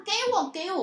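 put-on high, loud child's grabby whine; imitating the kid demanding a cookie; whiny kid demand. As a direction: beg like a small child.

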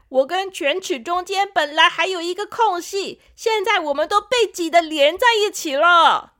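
high, loud, fed-up lecture; big brother tooth. Acted. Older tooth lectures the two new brothers, fed-up. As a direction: tell off the new arrivals. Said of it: "左二哥哥说".

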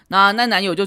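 ordinary delivery

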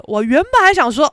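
ordinary delivery

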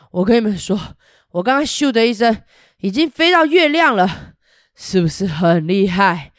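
loud, proud dragon bragging; boastful dragon. Acted. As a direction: boast to the children. Dragon boasts of moon flight. (大声炫耀的喷火龙)

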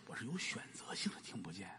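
patient confessing something embarrassing to the doctor; embarrassed whisper. Acted.

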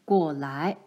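low, stern call; low and grave, a father about to scold; father calls his daughter over, low and stern. A narrator's reading. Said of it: "他的样子好可怕".

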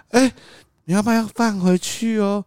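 high, slow sing-song warning; sing-song coaxing. Retold or imitated. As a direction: sweetly tell the toddler to put it back. (高声放慢，哄小孩放回去)

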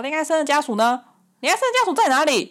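hammed-up hollering for the patient's family; imitating a shout. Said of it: "就用这种吼的方式哦".

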